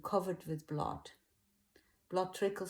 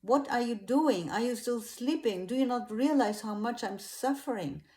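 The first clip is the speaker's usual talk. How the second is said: higher, louder urgent plea; reproachful call, pitched up and forceful; 5 semitones higher, 6 dB louder than usual. A narrator's reading. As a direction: rouse them with raised, insistent voice. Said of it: "And he calls".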